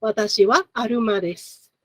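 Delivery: "aruma" said the Japanese way, with an r in place of the l; Japanese accent. Shown as a demonstration. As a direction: Japanese pronunciation of "Watashi wa aruma desu" with r for l. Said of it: "There is no L sound".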